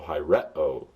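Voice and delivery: "hi re o" with the accent on RE; stress on RE. Shown as a demonstration. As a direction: stress the second-to-last syllable "re" in "hi re o". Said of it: "an acute accent on the second to last syllable".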